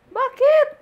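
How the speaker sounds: very high, loud, rising cry of disbelief; loud high shocked call to mother; loud shocked why. Real, not acted. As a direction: shout it in disbelief. Repeats even louder.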